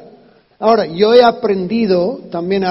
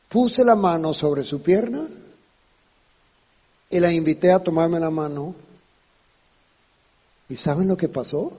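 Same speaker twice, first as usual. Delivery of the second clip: ordinary talk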